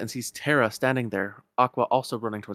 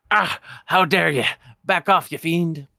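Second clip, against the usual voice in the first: higher, louder Scottish miser voice; indignant old Scotsman, raised and rolling; Scrooge McDuck; 6 semitones higher, 7 dB louder than usual. Acted.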